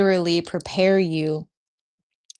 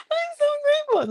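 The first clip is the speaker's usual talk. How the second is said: squeaky, much higher, teary-happy voice; overwhelmed gratitude; faster than usual. Retold or imitated. Retelling her grateful morning to viewers, squeaky high overwhelmed voice. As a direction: squeal it out like joy is too much to hold. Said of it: "I was like".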